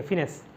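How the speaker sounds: फिनेंस repeated as "fi-NESS"; correct pronunciation. Shown as a demonstration. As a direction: say "fi", not "fai", to open फिनेंस. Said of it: "फाइनेंस को pronounce करोगे".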